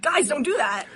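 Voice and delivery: mild teacher scold to the class; teacher warning. Retold or imitated. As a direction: warn the kids like a teacher.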